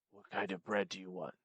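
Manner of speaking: very low, hushed Addams Family servant; barely alive, deep and soft; ominous, barely sentient. Retold or imitated. Counter worker asking a customer's order, ominous and barely sentient. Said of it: "Ominous and still. Barely sentient".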